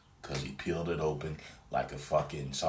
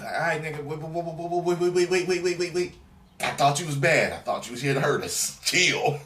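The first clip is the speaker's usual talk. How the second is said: high, louder, hurried begging; panicked pleading; 9 semitones higher, 4 dB louder than usual. Retold or imitated. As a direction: back off in mock panic.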